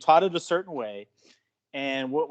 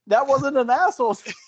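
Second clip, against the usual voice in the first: indignant protest; 13 semitones higher, 6 dB louder than usual. Retold or imitated. Protesting the asshole label.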